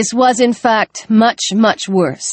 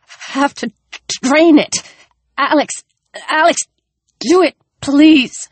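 wounded man pleading; 5 semitones higher than usual. Acted.

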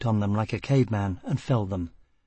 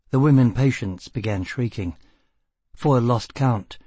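ordinary delivery

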